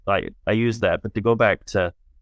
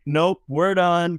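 higher, slow, firm mom voice; mom ending it; 9 semitones higher, slower than usual. Retold or imitated. Mortified mom cuts the conversation off, higher and slow. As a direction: draw it out like a mother who has had enough. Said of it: "his mom at that point is like".

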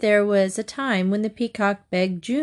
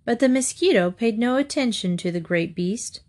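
ordinary delivery, nothing marked

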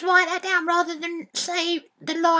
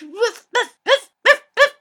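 louder, higher woofs building up; barking dog; 4 semitones higher, 9 dB louder than usual. Retold or imitated. Boy barking to show dad a real dog sound, louder each time. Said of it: "It goes".

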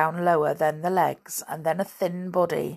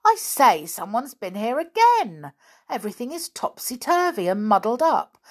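raised pitch, startled schoolboy discovery; sudden exclamation; 6 semitones higher, 4 dB louder than usual. A narrator's reading. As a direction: exclaim at what you've just found. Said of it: "He gave a sudden exclamation".

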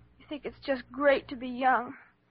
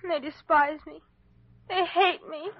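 louder and higher, rising to a pained cry; anguished outburst; 5 semitones higher, 5 dB louder than usual. Acted. Girl telling the doctor about her parents, anguished outburst. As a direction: let the hurt burst out.

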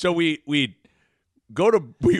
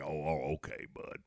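very low, fading coach mumble; Coach Altman; 14 semitones lower, 17 dB quieter than usual. Retold or imitated. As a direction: imitate a coach quietly giving in. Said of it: "do the impression".

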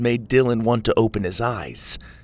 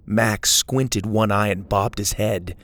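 usual speaking voice

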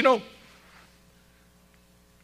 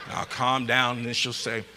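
ordinary talk, nothing marked

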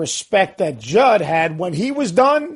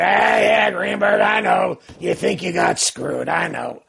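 Judd Heathcote's gruff voice; slower than usual. Retold or imitated. Coach teasing a rival at the banquet, gruff voice. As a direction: imitate a gruff coach holding court. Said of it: "you know that gruff voice he had".

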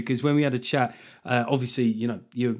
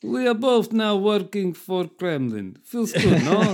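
put-on slow, higher comic Russian accent; 7 semitones higher, slower than usual. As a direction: drawl it like a Kremlin agent. Continues Kremlin joke in accent.

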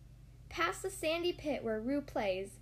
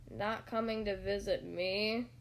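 low, glum donkey voice; dejected, low-pitched question; gloomy Eeyore; 4 semitones lower than usual. A narrator's reading. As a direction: mope it out, expecting disappointment. Gloomy Eeyore greets visitor.

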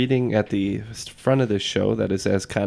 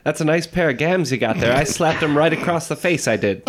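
transatlantic starlet voice, higher; old Hollywood actress; 5 semitones higher than usual. Pretend. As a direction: gossip like a classic film star in transatlantic accent. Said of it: "Humphrey said to me".

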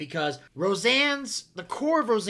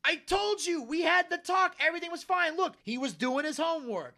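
high-pitched, insistent exasperation; punchy raised-pitch protest; mock arguing; 7 semitones higher than usual. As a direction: imitate a husband defending himself heatedly. Retold husband argues with wife.

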